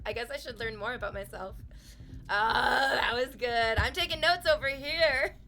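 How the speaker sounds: usual speaking voice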